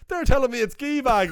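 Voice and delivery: high, loud mock outrage; complain, baffled; indignant disbelief. Kane Hodder reporting the Irish verdict, high indignant disbelief. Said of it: "He says".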